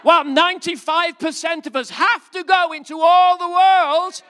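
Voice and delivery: very high, loud, laughing mock outrage; mock incredulous preaching. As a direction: tease them with over-the-top disbelief. Mock envy while preaching.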